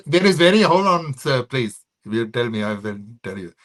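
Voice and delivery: hammed-up Indian guy accent. Shown as a demonstration. Comic shows one of his Indian guys, mock Indian accent. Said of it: "four different Indian guys".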